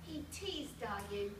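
plain reading voice

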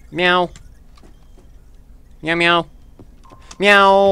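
high, playful cat meows; cat meowing. Pretend. Player idly meowing through the game, high cat voice.

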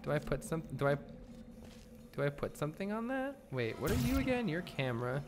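ordinary talk

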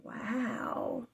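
plain reading voice

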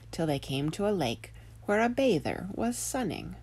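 ordinary delivery

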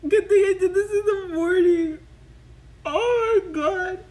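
loud and high, still giddy; elated. Real, not acted. Fan glad she filmed in the morning, loud elated voice. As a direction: exclaim it, overwhelmed with joy.

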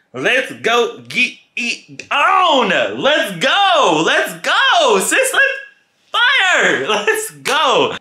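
very high, loud hype squeal; wild celebration. Acted. Storyteller hyping himself up after a proposition, wildly excited. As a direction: cheer it out loud and drawn out, way up high.

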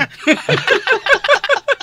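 loud, high-pitched burst of laughing; long squealing laugh; high laughter. Real, not acted. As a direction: crack up at your own story. Teller laughs at own story.

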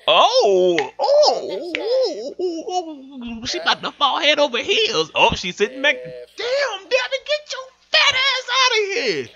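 high excited then angry; squeal, then shoo her off. Real, not acted. Excited then furious at intruder.

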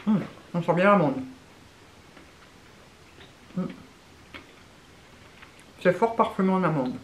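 talking between bites of snack; chewing while talking. Real, not acted. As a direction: mouth partly full of biscuit. Seen 0: Woman holds biscuit stick, cheeks bulge, jaw working while speaking.

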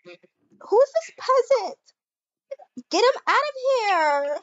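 put-on haughty rich girl. Rich girl scorns the intruder.

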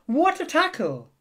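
high, loud cheer; spectators' excited shout; excited cry. A narrator's reading. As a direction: cry out in admiration from the sidelines. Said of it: "they cried".

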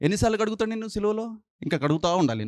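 normal talking voice

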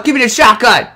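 ordinary talk, nothing marked

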